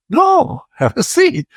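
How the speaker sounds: eager welcome. Retold or imitated. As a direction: jump at the offer, voice shooting up. Eager reply to a woman.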